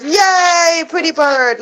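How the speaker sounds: high, loud mock cheer for the bird; imitating a cheer. Husband cheering on the pet bird, high mock cheer. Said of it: "my husband cheers him on, like, and goes".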